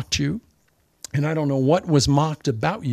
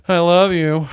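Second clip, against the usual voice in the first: louder, mocking "I love you." stressing love; sarcastic love you; 4 dB louder than usual. Shown as a demonstration. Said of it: "You can say".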